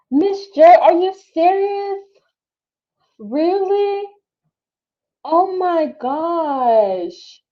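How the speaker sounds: can't believe what chat just said; stunned disbelief. Real, not acted. Streamer answering chat about the runner, stunned and slow. Reacting to chat's news.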